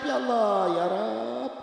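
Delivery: soft, acted weeping cry to God; mock-tearful drawn-out prayer; tearful plea. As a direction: plead quietly like a heartbroken mother. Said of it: "Dia nangis".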